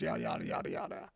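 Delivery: low, soft throwaway mumble; dismissive trailing-off. Retold or imitated. Preacher skipping through the disciples' answers, low and dismissive. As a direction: let it sink away as filler.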